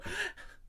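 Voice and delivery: sharp, high mock gasp; imitated gasp. Imitating Janet at the table, shocked gasp. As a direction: suck in a shocked breath. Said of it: "literally just go".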